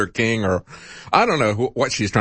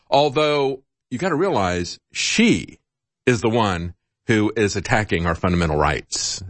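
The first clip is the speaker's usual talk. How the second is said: ordinary delivery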